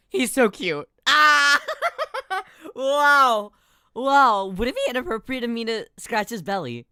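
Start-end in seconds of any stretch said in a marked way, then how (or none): 0.1-4.5 high, loud excited cry. Real, not acted. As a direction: gasp and cry out in delight. Delight at cute guest.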